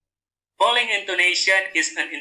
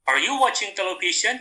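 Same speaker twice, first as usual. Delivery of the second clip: higher-pitched question with "television" turned upward; rising intonation; 4 semitones higher than usual. Shown as a demonstration. Teacher repeats an example question for students, rising intonation. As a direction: raise the pitch at the end of "television". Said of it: "So the intonation is rising".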